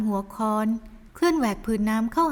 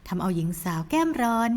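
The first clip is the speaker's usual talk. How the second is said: plain reading voice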